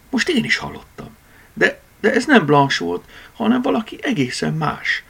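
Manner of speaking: high, strained frightened cry; groaning in fear. A narrator's reading. Cat hears a strange voice from the cellar, groaning in fear. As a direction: blurt it out, unnerved. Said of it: "nyögött fel Borneo".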